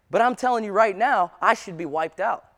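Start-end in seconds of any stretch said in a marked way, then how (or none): none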